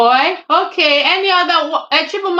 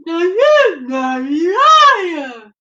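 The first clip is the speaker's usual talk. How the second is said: "A huge snarly lion" slow, high, loud, dramatic; imitating emphasis; 3 semitones higher, 4 dB louder, much slower than usual. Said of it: "What's the emphasis for?".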